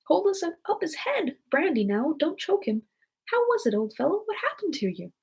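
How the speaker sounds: high-pitched fussing onlookers; concerned helpers, voices jumping high; confusion of voices. A narrator's reading. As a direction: fuss over the patient in high character voices. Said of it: "another confusion of voices".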